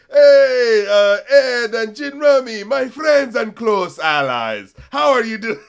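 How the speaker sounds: high, loud, drawn-out clerk greeting. Retold or imitated. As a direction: hail them loudly, stretching the words slowly. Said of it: "I just love the way they set it up".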